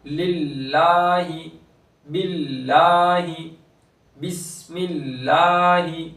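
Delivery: slow chant of लिल्लाहि with a light, thin लाम; thin lam recitation. Shown as a demonstration. Teacher recites the word to show the thin lam. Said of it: "ये लाम सारे बारीक होंगे".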